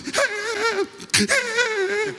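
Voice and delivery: very high, drawn-out gasping squeal; exhausted wheeze. Retold or imitated. Winded hooker trying to throw in, wheezing squeal. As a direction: squeal for breath, hammed-up. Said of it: "The hooker was like this".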